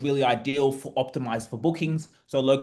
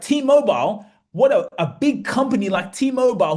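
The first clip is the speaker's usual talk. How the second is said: T-Mobile said higher and louder, rising; amazed disbelief; 6 semitones higher, 5 dB louder than usual. Retold or imitated. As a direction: sound stunned that a giant like T-Mobile is in Kenya. Amazed inner thought on the call.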